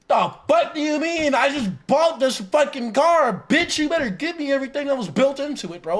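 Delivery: loud, high ranting, the last line rushed; angry rant. Real, not acted. Streamer ranting angrily at car subscriptions. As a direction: vent real fury at the carmakers. Outraged about paying for his car.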